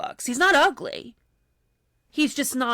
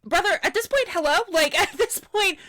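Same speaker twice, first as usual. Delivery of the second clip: cracking up in exasperation; exasperated laughing; 8 semitones higher than usual. Real, not acted. Exasperated at contestant.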